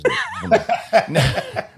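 cracking up mid-sentence; laughing. Real, not acted. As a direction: laugh through the words. Breaks into laughter.

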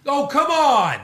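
high, loud exasperated protest; retold yelling. Viewer yelling at his TV, exasperated retold shout. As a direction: re-enact an impatient fan's holler. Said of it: "I was also yelling at my T V".

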